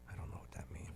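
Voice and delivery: unvoiced aside whisper. Real, not acted. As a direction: whisper it under your breath.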